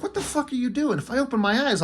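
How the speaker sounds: high, alarmed yelp of panic; a sudden jump up in pitch, startled; freaking out. Retold or imitated. Cyclops panics when Jean removes his visor. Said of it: "he freaks out".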